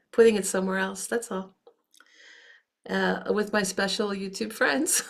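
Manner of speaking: ordinary talk, nothing marked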